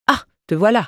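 a mock sneer at the dog. A narrator's reading. Mistress sneers at the dog under the table. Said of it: "ricana-t-elle".

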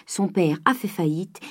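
ordinary delivery

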